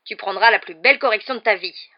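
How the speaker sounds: loud, furious roar of an uncle; roared threat. A narrator's reading. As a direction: roar the threat with full force. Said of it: "rugit l'oncle Vernon".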